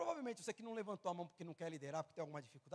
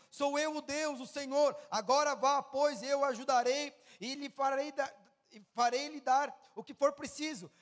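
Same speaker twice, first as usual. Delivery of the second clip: loud, high, slow preaching delivery; forceful scripture reading; 5 semitones higher, 10 dB louder, slower than usual. Acted. Preacher reading Moses and God's exchange to congregation, loud proclamation. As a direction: proclaim each phrase at full force. Reads the Bible passage at full force.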